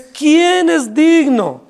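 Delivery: an angel's booming question echoing through heaven; powerful proclamation. Retold or imitated. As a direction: proclaim it like an angel's cry across the earth. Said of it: "con una voz potente".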